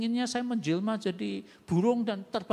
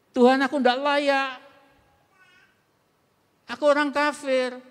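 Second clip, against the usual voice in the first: loud, higher, impassioned begging; pleading woman; 5 semitones higher, 9 dB louder than usual. Acted. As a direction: beg humbly but with full force. Syro-Phoenician woman's humble plea.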